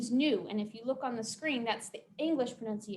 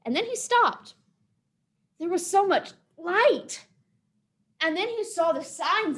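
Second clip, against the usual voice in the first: higher, louder, awed storyteller; dramatic storytelling; 6 semitones higher, 6 dB louder than usual. A narrator's reading. Storyteller at the monster's sudden halt, heightened dramatic telling. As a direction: land each phrase with big suspense.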